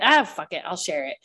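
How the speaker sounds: usual speaking voice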